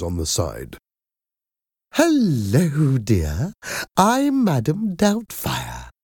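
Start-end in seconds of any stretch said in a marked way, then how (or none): 1.9-5.9 put-on high, slow, kindly old nanny; sweet Madam Doubtfire voice. A narrator's reading. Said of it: "the woman said in a kind voice".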